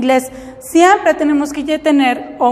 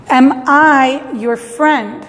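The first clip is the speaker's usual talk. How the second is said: slow, deliberate question, stress on I; slow model sentence; much slower than usual. Shown as a demonstration. Teacher modeling the correct English question slowly. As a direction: say each word slowly for learners. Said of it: "En español diríamos".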